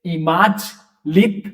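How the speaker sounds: इमाज लिथ said slowly and deliberately; slow French model. Shown as a demonstration. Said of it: "ठीक है".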